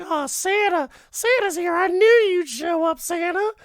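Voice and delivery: very high, loud squeal of delight; imitating a child overjoyed to see Santa; excited little daughter. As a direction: pitch it way up like a thrilled little girl. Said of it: "The daughter wakes up".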